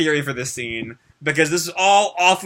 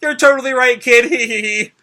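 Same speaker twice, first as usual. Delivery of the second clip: higher, louder words riding on laughter; still laughing; 8 semitones higher, 6 dB louder, slower than usual. Acted. Lucy agrees with Ryuji while still laughing, higher and louder. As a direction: agree through the last of the laugh. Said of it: "Lucy is now just laughing wildly".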